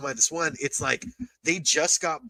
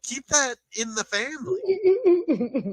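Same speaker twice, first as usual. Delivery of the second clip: high, slow whiny plea; mock whine; 11 semitones higher, slower than usual. Mock outrage, whining.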